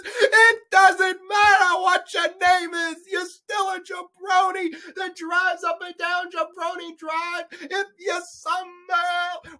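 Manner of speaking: high-pitched, drawn-out outburst; comic meltdown at a film character; exasperated rant. Acted. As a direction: stretch out every word in high, strained exasperation. Ranting at the film's character.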